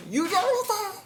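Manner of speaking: panicked cry. Acted. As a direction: shriek as if you just saw a ghost. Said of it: "이렇게 외치는 거예요".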